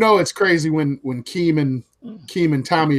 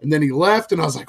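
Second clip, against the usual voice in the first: ordinary talk, nothing marked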